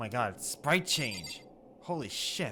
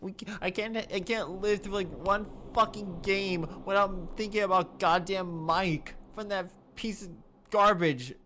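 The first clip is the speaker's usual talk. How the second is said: higher and louder, swearing in frustration; angry rant; 7 semitones higher, 4 dB louder than usual. Real, not acted. Player raging that the plot copies another game. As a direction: vent at the game, getting louder.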